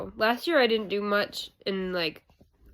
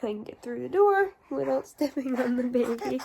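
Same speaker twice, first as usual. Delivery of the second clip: ordinary delivery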